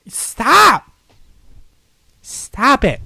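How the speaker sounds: high, loud panicked yell; scared shout. Real, not acted. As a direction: shout it in fright. Frightened by game.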